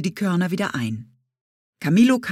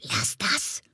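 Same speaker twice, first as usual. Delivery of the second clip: softer, lower whispered warning; 5 semitones lower, 5 dB quieter than usual. Acted. Parrot warning the boy away from the oak, hushed. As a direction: hush him urgently. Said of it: "flüsterte ihm Camillo zu".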